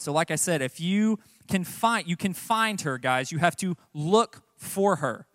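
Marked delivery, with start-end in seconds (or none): none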